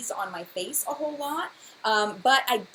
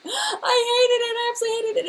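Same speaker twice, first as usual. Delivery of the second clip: mock crying; 13 semitones higher, 6 dB louder than usual. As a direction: fake sob of disgust over it. Exaggerated disgust at a primer.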